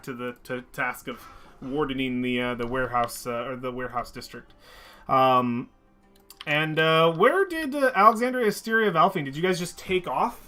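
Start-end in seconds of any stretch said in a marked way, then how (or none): none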